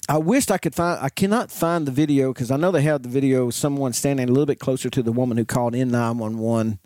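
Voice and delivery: normal talking voice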